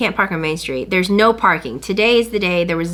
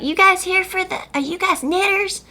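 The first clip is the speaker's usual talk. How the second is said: friendly helper voice; 11 semitones higher than usual. Retold or imitated. Umbrella man voiced in story.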